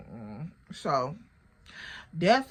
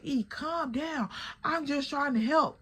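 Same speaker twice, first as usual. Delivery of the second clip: imitating a friend calming her down; 6 semitones higher than usual. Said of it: "So she like".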